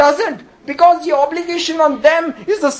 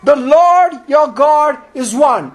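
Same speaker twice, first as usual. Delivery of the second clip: loud, drawn-out proclamation; ringing, stretched-out words of a commandment; declaimed scripture; 3 dB louder, slower than usual. Acted. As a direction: proclaim it slowly to a whole congregation. Preacher declaims the quoted commandment.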